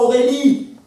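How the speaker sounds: put-on loud, high cry to a lost girl; climactic, grieving outcry; anguished call. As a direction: call the name out at full voice.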